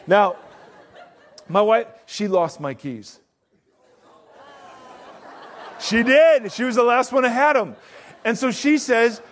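loud, high, driving sermon voice; preaching at full force. Acted. Preacher telling the congregation his van story at full force. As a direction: belt the story out to the whole room.

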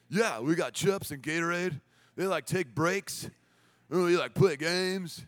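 slow, stretched skater dude voice; skate camp guys. Retold or imitated. Skate guy lists camp perks to a mom, in a slow dude voice. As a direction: drag out the words like a skater dude. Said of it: "this is her impression of these guys".

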